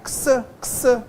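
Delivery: "x" said as a short "ksuh", twice; X sounded as ks. Shown as a demonstration. Teacher showing two sounds in one letter, "x" as "ksuh". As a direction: sound out "x" as k plus s. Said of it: "A letra X que vai soar".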